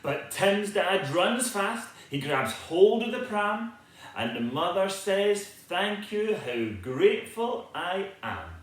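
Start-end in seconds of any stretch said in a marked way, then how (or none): none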